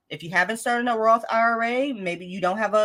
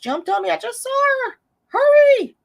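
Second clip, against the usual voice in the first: high squeal, louder on the last word; high-pitched urgency; 6 dB louder than usual. Real, not acted. Host hunting for a friend's comment in the chat, high urgent squeal. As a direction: squeal it out in a hurry.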